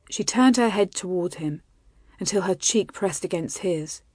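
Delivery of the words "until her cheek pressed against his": ordinary delivery, nothing marked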